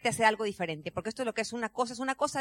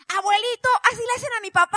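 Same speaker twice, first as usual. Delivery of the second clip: put-on high, loud child's voice; little girl's outburst; 10 semitones higher, 11 dB louder than usual. Granddaughter calling out to her grandfather, high and loud. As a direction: pipe up like a small child on stage.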